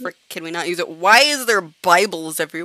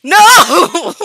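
high, drawn-out yell; loud refusal; 9 semitones higher, 13 dB louder than usual. Real, not acted. Gamer spotting a crowd on both sides, loud yelled refusal.

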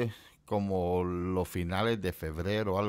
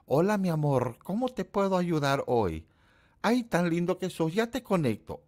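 lightly voiced sweet-talking operator, higher; a hint of a cooing phone operator; 7 semitones higher than usual. The phone operator greeting a caller, lightly voiced and higher. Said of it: "las operadoras siempre decían".

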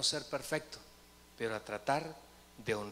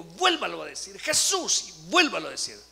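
loud, forceful exhortation; retold exorcist's insistent shouting; urging repetition; 4 semitones higher, 9 dB louder than usual. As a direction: press them to shout it once more. Retold urging to repeat the name.

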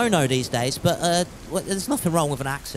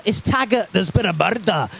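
put-on Taggart Scottish accent. Streamer reads chat's Taggart catchphrase, Scottish accent. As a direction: do a Scottish detective announcing a crime. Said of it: "my mum used to watch Taggart".

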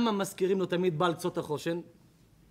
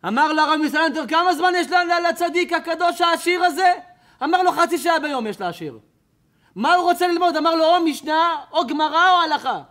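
high, loud impassioned preaching; preaching at full force; 9 semitones higher, 11 dB louder than usual. Acted. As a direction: cry it out to the crowd, pleading. Preacher's fervent retold dialogue. (דרשה בלהט ובקול רם)

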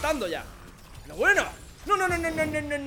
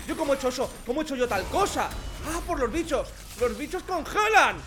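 ordinary delivery

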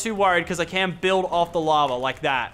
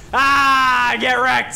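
taunting shout; 6 semitones higher, 5 dB louder, much slower than usual. Real, not acted. Taunting after a kill.

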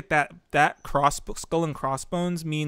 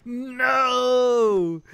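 high, drawn-out wailing no; anguished shout; 7 semitones higher than usual. Real, not acted. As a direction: cry out in dismay at a big fall. Reacting to a big fall.

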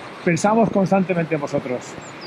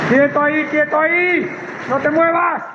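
calling out; 6 semitones higher than usual. Real, not acted. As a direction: call out to stop him moving. Llamando a alguien en el agua. (gritando para que no se mueva)

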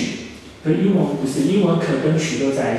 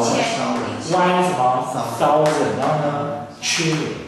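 slow read-out; much slower than usual. Shown as a demonstration. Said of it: "一千兆".

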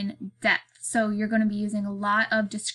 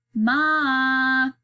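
loud, drawn-out call for Mom; child crying out; 3 semitones higher, 5 dB louder than usual. A narrator's reading. Child cries for Ma from inside, calling out. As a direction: holler it long, like a little kid. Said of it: "the voice cries again".

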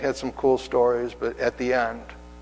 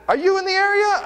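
high-pitched quoted question; eager question; 4 dB louder than usual. Retold or imitated. Patient asks the pastor if he is nearby, high eager question. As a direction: ask it way up high. Acted phone exchange with patient.

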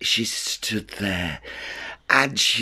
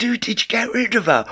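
high, quick mock-innocent ask; innocent question; 10 semitones higher, much faster than usual. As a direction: play it all innocent and quick. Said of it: "she all innocently".

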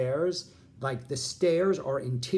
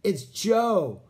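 higher and louder, building to a shrieked yell; imitating a caller whose blurted news ends in a scream; voicemail message; 9 semitones higher, 7 dB louder, much slower than usual. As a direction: replay the voicemail, climbing until you scream the last words. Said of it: "the only message I hear is".

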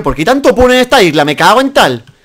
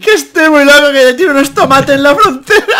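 higher, louder mock threats while laughing; imitating laughing; 8 semitones higher, 4 dB louder than usual. Friend repeating the French joke, laughing. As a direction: quote the joke, cracking up. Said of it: "¡Míralo, es francés!".

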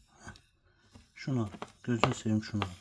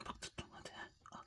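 whispered coaxing to a pet; barely voiced, coaxing the animal; 26 dB quieter, faster than usual. Real, not acted.

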